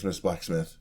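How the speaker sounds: ordinary talk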